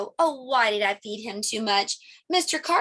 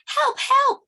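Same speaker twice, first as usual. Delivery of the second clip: high, loud cry for help; calling for help; 5 dB louder than usual. Acted. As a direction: call out for help in a panic. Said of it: "I said".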